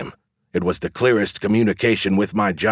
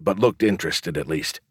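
ordinary delivery, nothing marked

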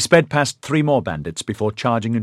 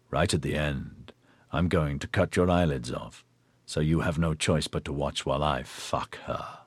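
dark pleasure; 5 semitones lower, 8 dB quieter than usual. A narrator's reading. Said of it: "a burst of dark pleasure".

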